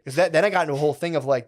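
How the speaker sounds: ordinary talk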